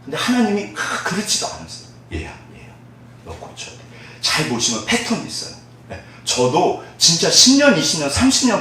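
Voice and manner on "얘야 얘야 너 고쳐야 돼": softly whispered as God, tender. Acted. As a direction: hush it like God speaking to a child. God voiced in sermon. (하나님 목소리로 속삭이듯)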